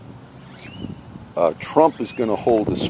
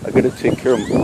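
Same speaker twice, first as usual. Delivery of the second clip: normal talking voice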